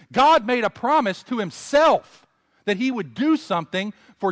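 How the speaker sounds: rising loud sermon climax; preaching at full force. Acted. Preacher proclaiming God's promise to the congregation, loud and high. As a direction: lift the room with conviction.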